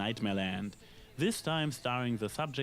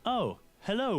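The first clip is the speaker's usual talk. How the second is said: surprised; 9 semitones higher, 3 dB louder than usual. Real, not acted. Spots Luna on screen.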